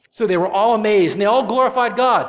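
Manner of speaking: loud, ringing and high; jubilant proclamation. A narrator's reading. The crowd praising God, loud and joyful. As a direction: proclaim their amazement with joy.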